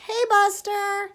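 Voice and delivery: high, slow sing-song call to the dog; high coaxing pet call. Real, not acted. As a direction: coax the dog back in a high voice. Calling the dog around room.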